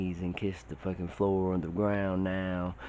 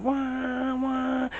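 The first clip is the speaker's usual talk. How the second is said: high wailing wah of someone falling; comic high-pitched falling cry; falling scream. Retold or imitated. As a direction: wail it out as you drop. Screaming while falling from plane.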